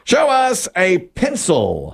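high, slow announcer call; drawn-out suspenseful reveal; game-show reveal call. Real, not acted. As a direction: call to the board, stretching each word. Host calls for answer reveal.

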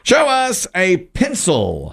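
high, drawn-out announcer call; game show reveal call. Real, not acted. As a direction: stretch it out for the big reveal. Host calls for the board reveal.

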